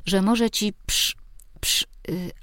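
stuck on "psz", repeating it; small boy struggling to get "psz" out; stumbling child. A narrator's reading. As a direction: trip over "psz", then hesitate with "y". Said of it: "Zrezygnował z trudnego słowa".

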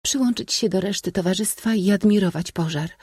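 high-pitched lady's voice; princess. A narrator's reading. Princess sending the suitor back to company, high-voiced. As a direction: suggest it in a high, feminine tone.

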